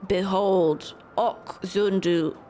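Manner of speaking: a grave bird chief giving formal thanks; ceremonious creature elder, unhurried; Vanu chieftain voice. Acted.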